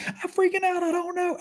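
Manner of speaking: very high-pitched frantic wail; mock panic. Woman who bit him explains herself, mock panic.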